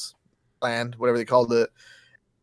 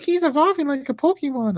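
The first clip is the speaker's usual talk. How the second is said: put-on much higher, excited little kid; light imitation of a child at the movies; 13 semitones higher than usual. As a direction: squeak it like an eager kid. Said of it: "one of the kids is like".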